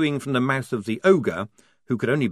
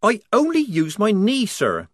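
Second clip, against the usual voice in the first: protesting; 10 semitones higher, 4 dB louder than usual. Acted. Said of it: "Polly protested".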